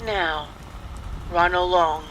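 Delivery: usual speaking voice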